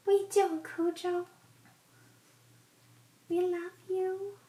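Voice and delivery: high sing-song pet talk; talking to a dog. Real, not acted. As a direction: coo lovingly at the dog. Cooing at the dog Cujo.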